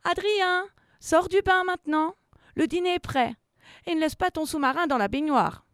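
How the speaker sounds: high, loud voice carrying to the bathroom; mother calling. A narrator's reading. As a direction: call the child out of the bath. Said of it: "une grosse voix résonne". (voix forte de maman qui appelle)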